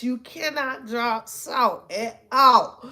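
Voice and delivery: slow, word-by-word insistence; deliberate, drawn-out warning; emphatic. Real, not acted. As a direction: lay down the rule, spacing out every word. Emphatic repeat of the rule.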